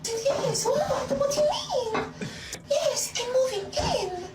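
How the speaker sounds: very high, put-on French accent. Woman feigning surprise that he didn't know she's moving in, French accent. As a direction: innocent French act in a high voice. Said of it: "I spoke in a French accent".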